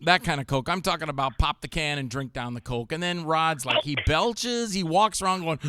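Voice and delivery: ordinary talk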